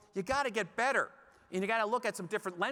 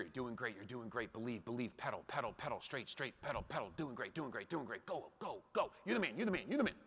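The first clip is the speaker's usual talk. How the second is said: put-on soft, hushed coaching in a child's ear; whispered encouragement; 5 semitones lower, 7 dB quieter than usual. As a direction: murmur it close, urging him on. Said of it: "you're whispering in his ear".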